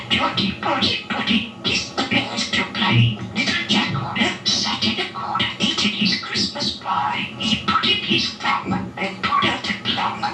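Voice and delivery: usual speaking voice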